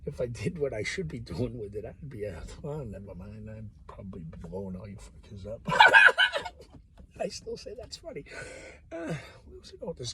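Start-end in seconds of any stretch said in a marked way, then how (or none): 5.7-6.8 big high cackle at his own joke; loud burst of laughter. Real, not acted. Laughing hard at his own dark joke.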